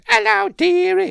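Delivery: very high, loud falsetto come-on; hammed-up falsetto greeting from a tavern woman; flirty woman impression. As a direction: put on a squealing mock-female voice. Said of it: "I bet she's saying that".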